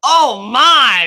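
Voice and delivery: mock commentator exclamation, high and loud; Michael Cole. Streamer mimics a wrestling commentator's catchphrase, high and loud. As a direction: belt it out like an excited ringside announcer. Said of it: "Yeah, Michael Cole".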